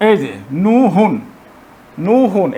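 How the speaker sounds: নুহুন drawn out slowly, syllable by syllable; slow syllable demo. Shown as a demonstration. Teacher sounding out a word slowly for learners. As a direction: spell out নুহুন slowly for the student. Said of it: "নু হু".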